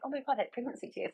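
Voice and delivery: quick, hushed gasp of remembering; sudden realisation. Retold or imitated. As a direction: blurt it fast as it dawns on you. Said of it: "I was like".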